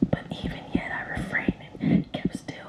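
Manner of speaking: ordinary delivery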